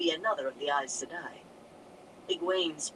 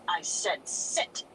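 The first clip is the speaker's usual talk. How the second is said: sharp stern order; whip-crack command; slower than usual. A narrator's reading. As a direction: snap it like a whip. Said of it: "The Amyrlin's voice cracked like a whip".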